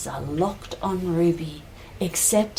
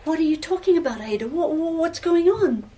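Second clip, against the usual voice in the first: raised-pitch, stammering confusion; bewildered questioning; 7 semitones higher than usual. Acted. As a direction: stammer as you ask her to explain. Groom baffled by bride's words.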